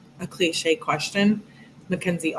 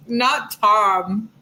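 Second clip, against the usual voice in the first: laughing; 2 semitones higher, much slower than usual. Real, not acted. Laughing at own joke.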